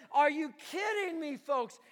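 loud, high, slow preaching; impassioned preaching. Retold or imitated. Paul urging the folks to stand firm, loud impassioned preaching. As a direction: plead with the folks, full force. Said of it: "Paul was saying here this".

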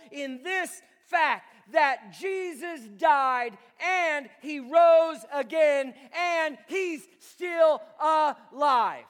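slow, loud, high proclamation; climactic preaching. Real, not acted. Preacher declaring Jesus alive to the church, slow booming. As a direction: drive each word home.

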